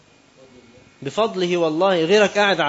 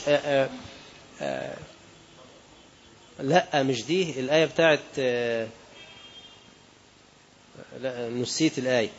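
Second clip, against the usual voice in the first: much softer, trailing murmurs while recalling; searching memory; 3 semitones lower, 9 dB quieter than usual. Real, not acted. Preacher losing the verse mid-sermon, murmuring softly to himself. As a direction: mutter to yourself trying to recall the verse.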